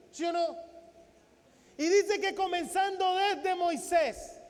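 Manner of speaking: ordinary talk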